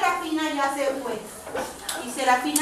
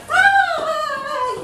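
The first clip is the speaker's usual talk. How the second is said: playful squeal; 11 semitones higher than usual. Acted. Puppet squeals in the story.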